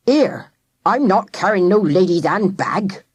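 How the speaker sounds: gruff working man voice; Talker protesting. A narrator's reading. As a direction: grumble it like a stubborn old handyman. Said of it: "protested Talker".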